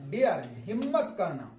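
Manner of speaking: ordinary talk, nothing marked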